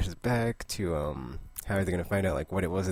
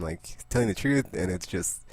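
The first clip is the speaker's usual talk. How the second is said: normal talking voice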